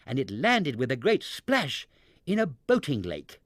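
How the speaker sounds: ordinary delivery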